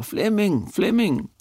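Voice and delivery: high-pitched retold cry of a name; calling out. Mourner at the grave cries out to the son, high-pitched call. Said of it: "der råber".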